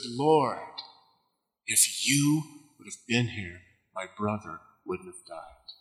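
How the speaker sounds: low, soft, bitter reproach. Retold or imitated. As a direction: let the grief turn sour and quiet. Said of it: "I detect a tone of bitterness in her voice".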